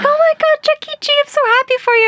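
very high-pitched excited friend; Amy Pape, ecstatic. Retold or imitated. Friend hearing the kiss news, imitated as ecstatic. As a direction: squeal it, imitating an overjoyed best friend. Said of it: "her Amy Pape voice, so excited".

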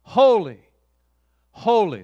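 loud, ringing cry of holy; loud angelic acclamation; proclaiming angels. Acted. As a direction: proclaim it like angels round the throne. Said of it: "they say".

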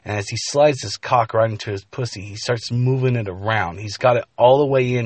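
plain reading voice

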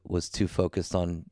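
ordinary talk, nothing marked

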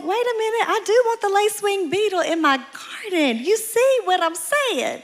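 high, eager change of heart; mock realization. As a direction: sell it like a sudden convert. Retelling sudden change of mind.

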